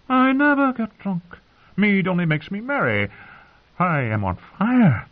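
put-on high, extravagant drunken boast; tipsy singer. A narrator's reading. Drunken singer denying it to the girl, high florid boast. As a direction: protest high, then stretch out the passion.

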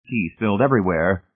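usual speaking voice

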